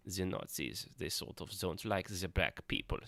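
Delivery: put-on corrected take in a mild German accent; mock German accent. Vlogger retrying the Nazi line, now in a soft German accent. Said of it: "I finally got the Russian accent, or the Nazi accent, the German".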